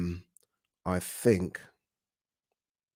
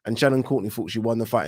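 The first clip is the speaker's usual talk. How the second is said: ordinary delivery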